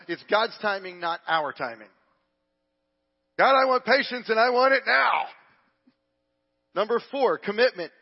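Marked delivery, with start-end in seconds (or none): 3.4-5.3 loud, high shouted prayer; mock impatient demand. Preacher imitating someone praying for patience, impatient shout. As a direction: bellow it as a comic tantrum at heaven.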